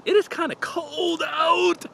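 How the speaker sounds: very high, drawn-out whine about the cold; exaggerated cold complaint. Real, not acted. Runner telling viewers it's cold out, exaggerated high complaint. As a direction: stretch it out slowly, way up high.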